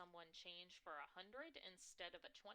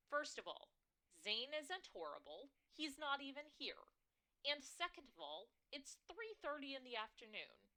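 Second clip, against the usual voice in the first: firm loud correction; 7 semitones higher, 6 dB louder than usual. Acted. Daughter correcting mother loudly.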